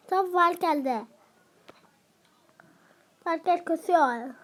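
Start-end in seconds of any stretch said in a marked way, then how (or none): none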